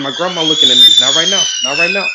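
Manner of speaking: loud, strained mock protest; fighting back laughter. Grandson at a funeral with grandma watching, loud stifled-laugh protest. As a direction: act out desperately holding in a laugh. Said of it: "I can't laugh right now".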